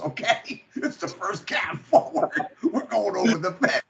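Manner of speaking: words breaking up into laughter; laughing retelling. Retold or imitated. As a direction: quote a friend through real laughter. Retelling friend's bright idea, laughing.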